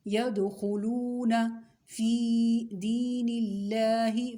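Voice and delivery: teacher reciting for pupils to copy, vowels drawn out; drawn-out recitation. Shown as a demonstration. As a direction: stretch each long vowel a full alif. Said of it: "इसमें आपने क्या देखना है".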